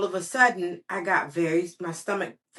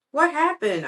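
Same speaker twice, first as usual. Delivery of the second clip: alarmed adult; 8 semitones higher, 5 dB louder than usual. Acted. Adult asks in hallway.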